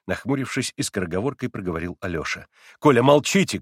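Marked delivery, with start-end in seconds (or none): 2.8-3.6 loud, higher command; urgent raised voice; cry of rebuke. A narrator's reading. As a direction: call out to silence the boy. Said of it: "крикнул он Красоткину".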